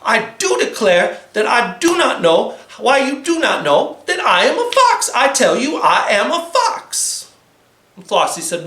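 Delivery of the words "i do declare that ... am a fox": high, huffy Southern-ish fox; upset fox, higher. Acted. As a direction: protest like a flustered fox. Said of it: "He got very upset".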